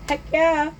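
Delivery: eager. Retold or imitated. Boys jump at V-Bucks offer.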